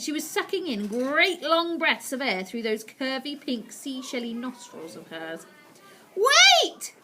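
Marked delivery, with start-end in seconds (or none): 6.1-6.9 very high, loud shout. Acted. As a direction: shriek a sudden halt. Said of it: "she shouted again".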